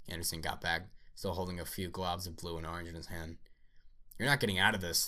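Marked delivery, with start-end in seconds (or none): 4.2-5.1 loud, menacing android roar. Acted. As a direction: bellow the threat. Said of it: "the android roared".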